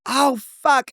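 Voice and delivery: high-pitched mock scream; retold scream. Screaming after hitting head in bathroom, mock scream. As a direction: cry out as if just hurt, without full volume. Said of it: "I, like, screaming".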